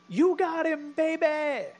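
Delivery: high, loud imitated wrestler holler; whooping high-pitched shout at a fan; Ric Flair yell. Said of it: "and he yells".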